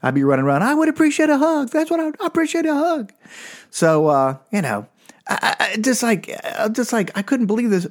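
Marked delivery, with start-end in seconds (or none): 0.6-3.1 high, quick needy whine; whiny kid plea. Pretend. Boy running around pleading to his dad, high and quick. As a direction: beg for a hug like a small boy.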